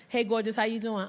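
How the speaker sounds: lightly voiced smooth guy; mock smooth pickup line. Man hitting on woman.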